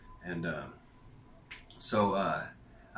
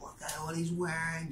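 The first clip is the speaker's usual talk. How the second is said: put-on much higher sneering old-lady voice; a high put-on older lady mocking a shirt; 11 semitones higher than usual. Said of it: "She's like".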